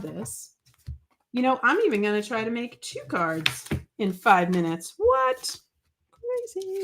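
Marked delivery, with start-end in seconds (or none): 5.0-6.8 squeaky high "What?" then a dropped "Crazy"; mock surprise. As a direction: play up fake shock at your own plan. Mock disbelief at two cards.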